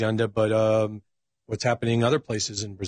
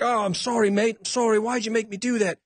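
high-pitched, pleading mock apology; imitating almost crying; 9 semitones higher than usual. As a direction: act out a killer begging forgiveness, voice pushed high. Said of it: "he's almost crying".

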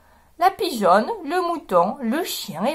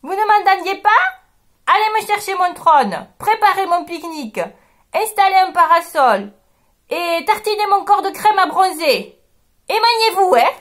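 haughty king barking orders, higher and louder; bossy lion; 6 semitones higher, 4 dB louder than usual. Acted. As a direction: order the servants about. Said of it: "leur dit". (le lion hautain donne des ordres)

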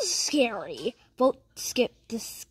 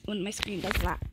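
normal talking voice

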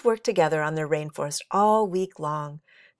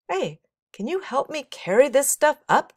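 louder, higher call up from below; 9 semitones higher, 6 dB louder than usual. A narrator's reading. Jeremy calls up to the treehouse from below, calling out. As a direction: call up to friends from the ground. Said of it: "called a voice from below".

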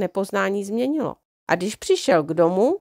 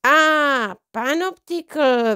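drawn-out cry of realisation, higher and louder; exclaiming; 6 semitones higher, 4 dB louder, much slower than usual. A narrator's reading. Copperfield realising where he is, exclaiming slowly. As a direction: it finally dawns on him. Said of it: "vykřikl pan z Copperfield".

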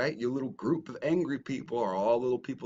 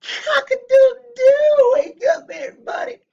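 rooster crow; 15 dB louder than usual. Retold or imitated. Mocking a rival who plays the rooster, in a loud, high rooster crow. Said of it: "Pretending to be the fucking rooster".

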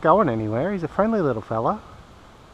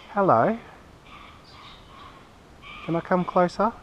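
higher, gentle hello to a bird; coaxing pet talk; 5 semitones higher than usual. Real, not acted. Man greets the kookaburra and asks to come closer, higher coaxing voice.

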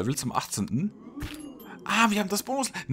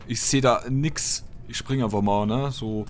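ordinary talk, nothing marked

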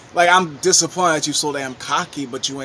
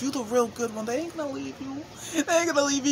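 mock singsong warning; 10 semitones higher, 4 dB quieter, faster than usual. Mock warning about toxic partners.

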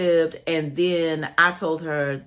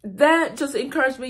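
higher, wavering with emotion; tearful; 7 semitones higher than usual. Real, not acted. Telling viewers about connecting with her, tearful. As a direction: hold back the tears as you testify. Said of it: "And I'm crying just like I am right now".

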